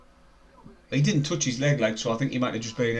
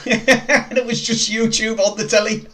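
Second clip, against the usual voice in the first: laughing; 8 semitones higher, 4 dB louder, slower than usual. Real, not acted. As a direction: say it while laughing, higher and slower. Laughing about the old joke.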